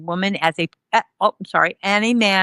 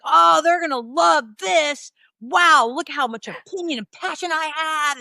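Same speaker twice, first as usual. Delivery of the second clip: high, gushing, hammed-up excitement; mock self-delight; 7 semitones higher than usual. Student sure assessors will love her essay, mock-thrilled. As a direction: gush over your own brilliance. Mocking her own naive confidence.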